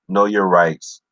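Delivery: ordinary talk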